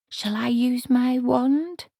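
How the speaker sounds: slow, high and unsure; hesitant. A narrator's reading. Margaret timidly offers Granny her wand, slow and high. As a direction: ask it timidly, slowly. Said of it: "said Margaret hesitantly".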